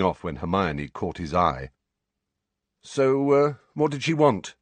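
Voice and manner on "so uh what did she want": feigned nonchalance, pitched up; mock casual. A narrator's reading. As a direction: ask as if it hardly matters, in a higher voice. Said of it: "in a mock casual voice".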